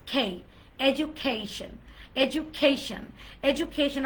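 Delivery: খেই with a puff, এডুকেশন as e-joo-KHAY-shn; aspirated k. Shown as a demonstration. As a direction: stress a breathy KHAY inside এডুকেশন. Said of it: "তারপর কেই না". (এডুকেশন শুদ্ধ উচ্চারণে, জু আর খেই)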